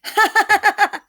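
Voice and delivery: high, rapid mocking laugh; staccato ha-ha-ha at a sibling's tumble; acted teasing laughter. A narrator's reading. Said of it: "Susie laughed".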